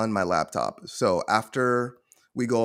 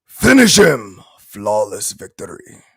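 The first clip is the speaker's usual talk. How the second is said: video game announcer impression; 12 dB louder, slower than usual. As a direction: imitate a fighting-game announcer, shouted then drawn out. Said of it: "I'm gonna do my best impression".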